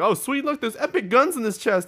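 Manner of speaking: high, loud gleeful voice; excited. Retold or imitated. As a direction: squeal it with glee. Imitating a teammate's excitement.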